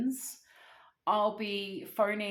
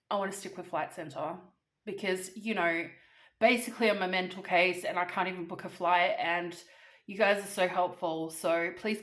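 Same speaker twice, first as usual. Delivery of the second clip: normal talking voice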